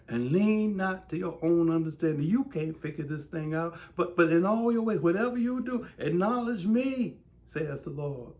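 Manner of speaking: loud, high rising proclamation; fervent gospel preaching; preaching God's promise. Acted. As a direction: proclaim it with full conviction.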